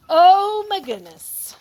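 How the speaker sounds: loud, high, slow exclamation; shocked. Real, not acted. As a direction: let the shock burst out. Shock at shark picture.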